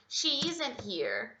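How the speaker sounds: slow, careful "isn't" spoken as one contracted word; slow contracted form. Shown as a demonstration. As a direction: model the contraction slowly. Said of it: "pode reduzir e falar she isn't". ("isn't" bem devagar, contraído)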